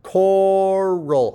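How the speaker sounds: "Coral" high and loud as "Co", then "rul"; split before R. Shown as a demonstration. Teacher showing the syllable break, "Co-ral". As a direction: stretch the first syllable of "Coral", then start on R. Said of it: "R is clearly at the beginning of the second syllable".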